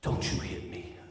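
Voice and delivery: breathless whisper; whispered threat. Retold or imitated. Partner whispering at man who hit her. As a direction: hiss it low after the slap.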